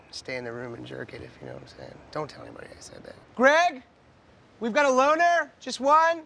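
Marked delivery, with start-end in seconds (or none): 3.3-6.2 loud high call across the restaurant; scream out. Acted. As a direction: yell the question to a waiter far away. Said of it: "Do you have to scream out?".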